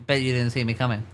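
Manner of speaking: ordinary delivery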